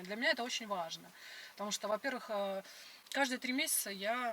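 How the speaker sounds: ordinary talk, nothing marked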